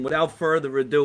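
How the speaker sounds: ordinary talk, nothing marked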